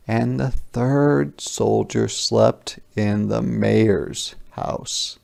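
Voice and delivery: ordinary delivery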